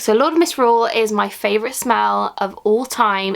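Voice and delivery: ordinary talk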